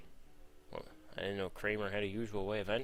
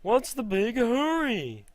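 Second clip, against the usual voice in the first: stupid high-pitched cartoon voice, loud and stretched out; rip-off B-movie voice, pushed very high; Jerry Seinfeld; 14 semitones higher, 8 dB louder, slower than usual. Jerry coming out of the bathroom, silly high voice. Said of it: "do a stupid voice for Jerry Seinfeld".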